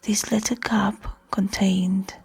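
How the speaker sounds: soft whisper over the cup sounds; quiet ASMR whisper. Shown as a demonstration. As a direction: keep it hushed and intimate. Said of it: "speak very softly".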